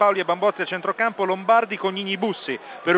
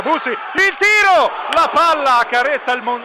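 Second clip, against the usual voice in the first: excited shot call; 6 semitones higher, 5 dB louder than usual. Real, not acted. Shot hits the post.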